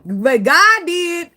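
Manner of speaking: high, loud, slow praise; slow high sermon praise; preaching. Real, not acted. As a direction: drag it out like a church shout. Preaching exclamation.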